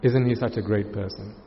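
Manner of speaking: hushed imitation of a dazzled observer; awed onlooker. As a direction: gush quietly like an impressed onlooker. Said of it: "And then to you, the observer".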